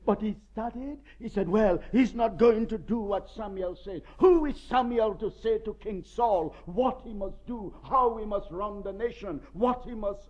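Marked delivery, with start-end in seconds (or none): 1.5-10.3 defiant Saul. Retold or imitated.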